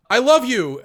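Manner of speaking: high, loud, hammed-up I love you; mock confession. Cohost mocking the kid's love confession, high and loud. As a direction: squeal it out like a lovestruck kid.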